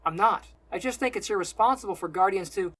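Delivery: loud, insistent denial; defensive protest. A narrator's reading. Selden protests before Simon cuts in, loud and defensive.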